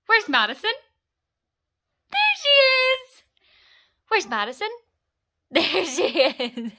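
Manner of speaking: loud sing-song question and slow, drawn-out reveal; hammed-up search, then delighted discovery; peekaboo game. Mother playing hide-and-seek with baby, excited peekaboo voice.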